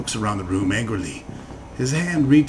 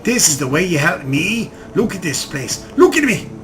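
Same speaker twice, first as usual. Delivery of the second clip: angry shouting; 5 semitones higher, 4 dB louder than usual. Acted. Addict rages at his state.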